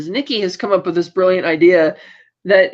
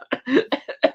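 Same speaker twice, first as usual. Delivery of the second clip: amused laugh; 7 semitones higher than usual. Real, not acted. Laughs at own quote.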